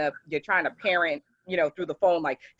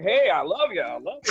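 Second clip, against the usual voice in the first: mock yell, a little louder; hearty retold holler; father yelling, imitated; 3 dB louder than usual. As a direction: imitate a dad calling out to his kid. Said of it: "and even he's yelling out".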